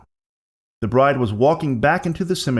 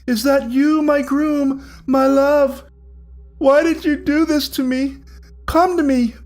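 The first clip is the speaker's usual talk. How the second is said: very high, louder, eerie lover's plea; ghostly bride's call; 13 semitones higher, 3 dB louder than usual. Acted. Ghost bride calling Willie her groom, high and eerie. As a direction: lure him in like a mad ghost bride.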